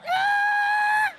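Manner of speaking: sudden high shriek of alarm; shocked driver shrieking; startled yelp. Acted. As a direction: yelp as the cow steps out. Startled by cow in traffic.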